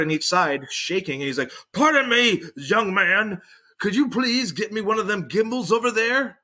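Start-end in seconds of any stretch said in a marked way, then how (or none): 1.7-6.4 high, quavering imitation of a shaky old man; frail old man. Old man asks the young man for the buggy, in a high feeble voice. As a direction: put on a feeble old man's voice, much higher.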